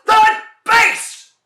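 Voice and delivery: high, loud exasperated yell; frustrated shout. Acted. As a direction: blurt it out in frustration. Frustrated answer in comedy routine.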